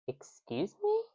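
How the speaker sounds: high, offended squeak of disbelief, kept soft; indignant excuse me. Retold or imitated. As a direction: shoot back an affronted "Excuse me?" under the breath. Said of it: "And I'm just like".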